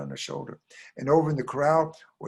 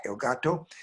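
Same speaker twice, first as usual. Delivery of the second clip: ordinary delivery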